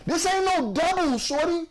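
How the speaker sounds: hurt, worked-up. Real, not acted. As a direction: plead your case in exasperated, high-pitched protest. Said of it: "the hurt in his voice".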